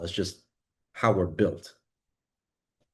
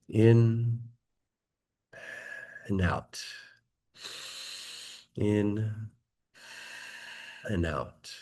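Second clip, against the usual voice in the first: guided meditation. Acted. Leads a meditation.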